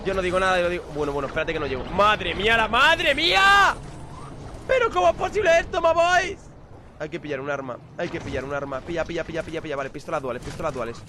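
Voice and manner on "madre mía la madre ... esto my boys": high, drawn-out disbelief; stunned gamer outcry; shocked exclamation. Real, not acted. Shocked at the chaos.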